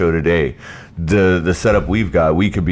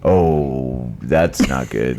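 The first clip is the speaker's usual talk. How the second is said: disappointed; 6 semitones lower, much slower than usual. Retold or imitated. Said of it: "I was like".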